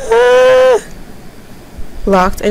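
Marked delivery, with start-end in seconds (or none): 0.1-0.8 sudden high yelp of fright; a quick jolt of a scream; startled yelp. Real, not acted. Startled by game scare.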